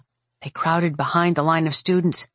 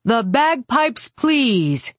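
higher-pitched, ceremonious cue; master of ceremonies; 6 semitones higher, much slower than usual. A narrator's reading. Mage cueing the bagpipers as the swarm nears, announcing voice. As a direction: present the act with a showman's courtesy.

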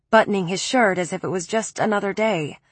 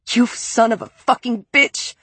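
raised, higher furious curse; furious yell; 4 semitones higher than usual. Acted. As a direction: lash out at him. Leaps at him yelling.

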